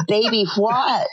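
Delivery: mock shocked mother yelling; startled mama. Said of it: "Mama goes".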